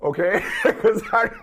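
breaking into a real laugh while talking. Real, not acted. Host cracked up by the butterface reference, laughing. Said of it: "you don't know why I'm laughing".

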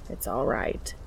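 soft, low whispered comfort; calm him with a hushed voice; reassuring. A narrator's reading. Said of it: "Cass reassures him".

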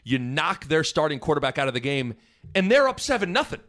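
ordinary talk

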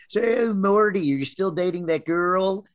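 hammed-up Baltimore family voice; Baltimore accent. Mocking his mom's side of the family in Baltimore accent. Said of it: "or whatever the fuck you guys say".

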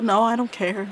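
ordinary talk, nothing marked

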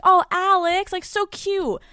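put-on high, slow, syrupy talking-down to a child; patronizing mock voice. Host mimics how the ballplayer talked down to her, high patronizing coo. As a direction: coo it like you're patting her on the head. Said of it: "That's how the Red Sox player talked to me".

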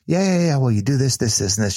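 quick offhand reply, starting high; offhand directions. Retold or imitated. Cyclist giving directions to a stranger, offhand. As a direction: imitate someone rattling off directions.

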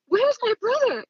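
high-pitched, demanding cry; re-enacted angry demand; retold yelling. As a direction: demand an answer in a high, urgent voice. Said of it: "just yelling at him".

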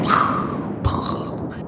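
breathy whispered thunder booms; fading whispered booms. Retold or imitated. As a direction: let the thunder die off in a whisper. Imitating thunder, whispered take.

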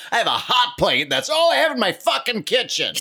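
high, loud mock outrage; indignant housewife. Housewife boasting of her hot plate, high and loud. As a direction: shriek about the hot plate.

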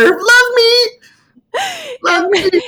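very high, loud mock plea; mock desperate cry. Drowning soul begging for love, mock desperate wail. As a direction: cry out as if going under, hammed up.

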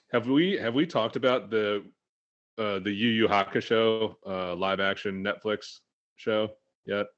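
normal talking voice

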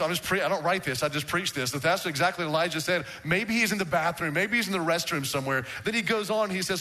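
usual speaking voice

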